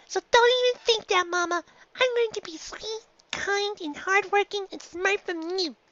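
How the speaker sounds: high filly voice, firm; a filly insisting; young filly Dinky, firm. A narrator's reading. Filly insisting to her mother, high and firm.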